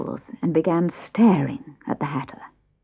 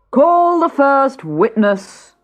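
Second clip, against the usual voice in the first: high, slow royal command; pompous king; 9 semitones higher, 6 dB louder, much slower than usual. Acted. King opens the trial, slow and commanding. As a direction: draw the order out grandly. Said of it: "said the king".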